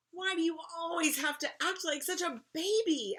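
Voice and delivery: put-on fed-up big-kid complaint; exasperated scolding. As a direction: scold a little sister. Violet scolds younger sister.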